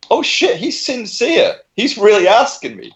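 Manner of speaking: dawning realisation. Retold or imitated. Clerk realising the customer is serious, slow mock surprise. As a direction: let the penny drop slowly.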